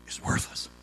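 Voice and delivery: breathy whisper of "Is worthless"; hushed, voiceless "Is worthless"; whispered repeat. Shown as a demonstration.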